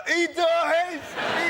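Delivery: high, loud mock yell; drunk passer-by. Drunk returning a minute later to the queue, high mock yell. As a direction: imitate the drunk coming back to shout it. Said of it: "like, a minute later".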